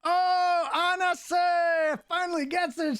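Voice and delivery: high, drawn-out celebratory shout; excited cheer. Real, not acted. Host cheers a viewer who won the code, excited shout. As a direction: shout the winner's name with real delight. Winner of giveaway code revealed.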